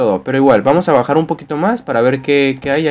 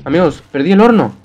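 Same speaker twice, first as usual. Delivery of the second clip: ordinary talk, nothing marked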